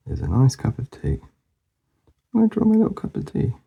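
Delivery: normal talking voice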